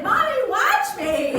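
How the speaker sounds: put-on high, loud, drawn-out kid voice; imitating an excited little girl; daughter showing off. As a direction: call to mom like a child wanting attention. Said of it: "my daughter's ... she's like".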